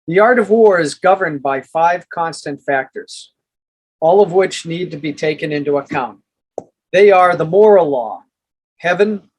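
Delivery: measured, emphatic audiobook narration; formal book reading. Acted. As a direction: read it out like a solemn treatise, pausing between phrases. Reads book chapter aloud.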